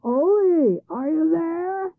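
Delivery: loud, high call, drawn out; shouting across. A narrator's reading. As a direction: call over to the next farm. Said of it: "the troll shouted across to Ole's farm".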